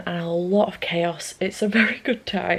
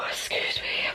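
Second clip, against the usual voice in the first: whispered; 7 dB quieter, slower than usual. Real, not acted. Sigh, tired late night.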